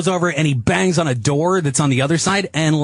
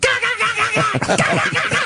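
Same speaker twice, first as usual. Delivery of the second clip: mock heated gibberish. Mimics escalating argument.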